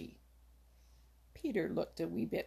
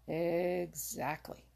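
snapped; much slower than usual. A narrator's reading. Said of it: "snapped Old Mother Nature".

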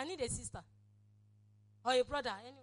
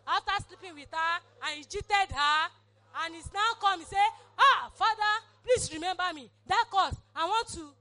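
a cheating brother begging Father, raised and high; pleading sinner voice; 4 semitones higher, 7 dB louder than usual. Acted. Preacher voices brother begging God, raised pleading. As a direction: cry out to God like a guilty son.

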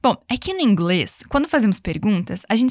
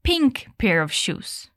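slow model phrase; 3 dB quieter, much slower than usual. Shown as a demonstration. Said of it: "a forma correta é".

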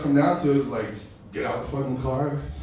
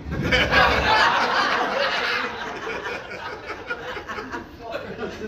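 laughing; 11 semitones higher than usual. Real, not acted. Cracks up after punchline.

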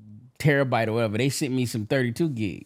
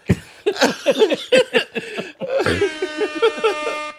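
slow, laughing admission; 7 semitones higher, much slower than usual. Real, not acted. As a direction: laugh through it. Laughing confession.

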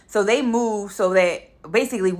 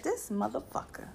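muttered curse; 2 semitones higher, 14 dB quieter than usual. Retold or imitated. Her muttered reaction to him driving off.

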